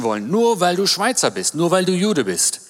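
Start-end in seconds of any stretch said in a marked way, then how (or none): none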